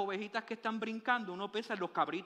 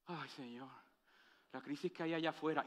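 mock lament; 6 semitones lower, 8 dB quieter than usual. Worried believer's lament quoted.

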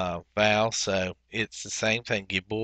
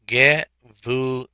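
"Gevule" slowly split as ge, vu, le; drawn-out, separated syllables in "Gevule"; 5 dB louder, slower than usual. Shown as a demonstration. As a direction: sound out "Gevule" with clear gaps between syllables. Teacher sounds out the new word.

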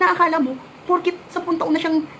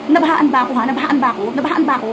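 louder, insistent mock challenge; indignant imagined retort; 5 dB louder than usual. Maid voices what she wished to tell madam, indignant retort. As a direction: fire the question repeatedly at an imagined boss. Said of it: "gustong gusto ko na sanang sabihin sa madam ko".

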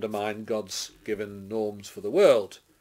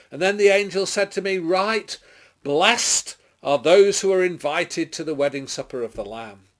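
raised, proclaiming voice that swells on the cries; dramatic scripture reading; 6 semitones higher, 7 dB louder than usual. A narrator's reading. Preacher reading Babylon's downfall to the congregation, declaimed. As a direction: declaim the prophecy with ringing force.

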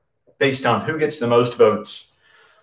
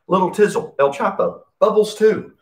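breathless, winded after a workout; out of breath; 9 semitones higher than usual. Real, not acted. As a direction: talk while catching your breath. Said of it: "Let me catch my breath".

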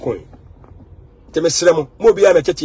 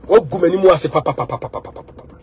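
ordinary delivery

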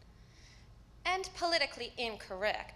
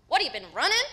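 suspicious mama; 13 dB louder than usual. Acted. Mama calls on the phone.

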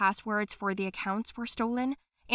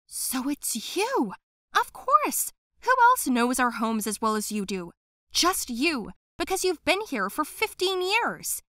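higher, indignant and triumphant; accusing discovery; 5 semitones higher, 5 dB louder than usual. Acted. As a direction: nail her as the thief. Girl unmasks the thief.

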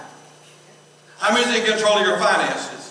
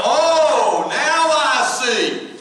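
high, drawn-out dawning understanding; mock realization; 5 semitones higher, much slower than usual. Pastor imagining listeners catching on, mock realization. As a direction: stretch it out in playful revelation.

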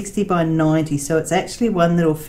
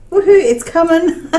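high, loud little cheer of delight; a real squeal of joy that the parcel is coming; excited cheer; 12 semitones higher, 7 dB louder than usual. Real, not acted. Thrilled about her custom painting shipping, excited cheer.

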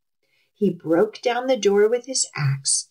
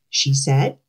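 ordinary delivery

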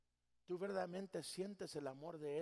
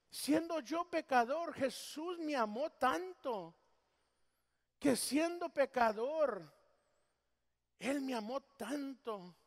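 slow emphatic testimony; 4 semitones higher, 5 dB louder, slower than usual. Real, not acted. Preacher slows for emphasis.

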